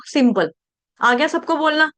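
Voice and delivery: ordinary delivery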